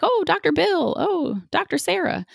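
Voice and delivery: put-on high, sing-song child greeting a doctor; high cheery kid voice; sweet kid voice. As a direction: chirp it like a little kid to the doctor. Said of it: "so you're just like".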